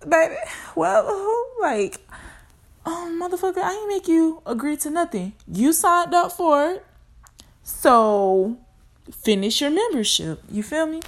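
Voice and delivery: high, sassy and loud; sassy scolding. Acted. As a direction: tell them off with attitude. Mock scolding someone, loud.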